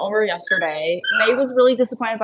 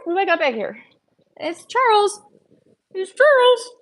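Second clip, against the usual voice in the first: high sing-song pet voice; talking to a dog; 12 semitones higher, 5 dB louder than usual. Real, not acted. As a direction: coo at the dog, high and bright. High baby talk to a dog.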